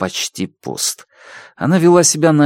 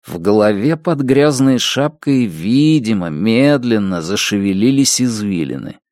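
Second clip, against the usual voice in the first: usual speaking voice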